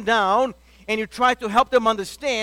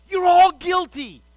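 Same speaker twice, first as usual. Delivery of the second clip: preached accusation; 8 semitones higher, 6 dB louder than usual. Acted.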